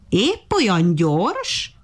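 very slow, high-pitched taunt; sneering monkey, stretched and lilting; mocking. A narrator's reading. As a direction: drag out the question to ridicule. Monkey's second mocking question.

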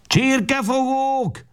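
very high, slow shriek of rage; furious cry. A narrator's reading. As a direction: scream at the boys. Woman strikes with carpet beater. (dühös asszony rikoltása)

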